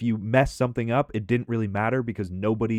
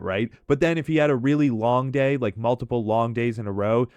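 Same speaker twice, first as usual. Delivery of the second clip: ordinary delivery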